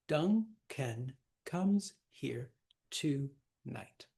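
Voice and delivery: slow, syllable-by-syllable verse with pauses; scanning the verse. Shown as a demonstration. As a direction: recite it one beat at a time. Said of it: "he's got the first three feet".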